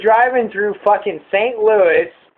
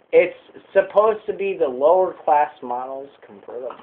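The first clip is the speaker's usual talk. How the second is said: normal talking voice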